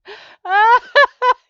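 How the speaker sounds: big high shriek of a laugh; loud high cackle; loud burst of laughter. Real, not acted. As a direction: let a huge laugh burst out. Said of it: "It was so funny".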